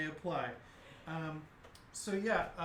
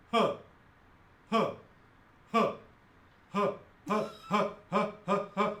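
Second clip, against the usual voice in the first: single heavy "huh" laughs, spaced then speeding up; staccato cyclops chuckles gathering pace; 2 semitones higher, 5 dB louder than usual. Acted. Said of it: "the Cyclops goes".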